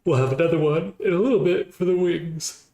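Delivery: words stretched through a yawn; yawning while talking. Real, not acted. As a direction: talk mid-yawn, mouth wide open. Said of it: "I was yawning at the same time".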